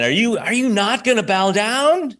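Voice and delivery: high incredulous voice for the accusers; mock accusing question. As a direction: play the accusers, baffled and high. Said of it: "they're saying".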